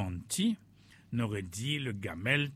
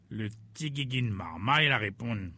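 ordinary delivery, nothing marked

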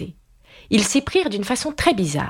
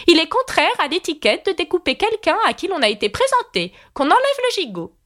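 high, lofty royal voice lecturing; haughty queen; 11 semitones higher than usual. A narrator's reading. Red Queen ordering the mutton removed, haughty and high. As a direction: rule on etiquette from the throne.

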